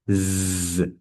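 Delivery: z buzzed with voice; z with humming vocal cords; voiced z. Shown as a demonstration. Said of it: "can we go".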